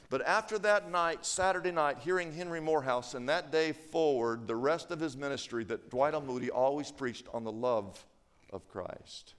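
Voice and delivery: low, softening preacher's voice fading to a near whisper; hushed preaching. Real, not acted. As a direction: let the conclusion sink in gently. Preacher brings story to hush.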